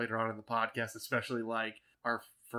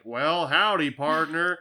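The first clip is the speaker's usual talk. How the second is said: louder, lightly voiced cowboy twang; a hint of John Wayne cowboy; John Wayne drawl; 4 semitones higher, 8 dB louder, slower than usual. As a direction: greet like an old Western cowboy. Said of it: "John Wayne-iness".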